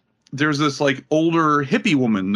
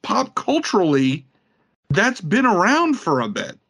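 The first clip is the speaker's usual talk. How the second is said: incredulous; 8 semitones higher than usual. Real, not acted. Continuing incredulous rant.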